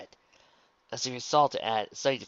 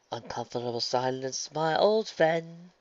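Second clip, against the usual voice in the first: slow, drawn-out inner grumble rising higher; angry thought; 5 semitones higher, 3 dB louder, slower than usual. A narrator's reading. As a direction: stretch out the last words with bitter irritation. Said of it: "Luna thought back angrily".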